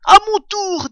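loud, high demand for a turn; eager badger. A narrator's reading. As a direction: pipe up impatiently. Badger eagerly claims the jar. (impatient, voix forte et aiguë)

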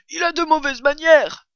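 blaireau outré, voix plus haute et plus forte; indigne-toi, en montant la voix; indignation. A narrator's reading. Blaireau indigné par le rat. (blaireau scandalisé, ton haut perché)

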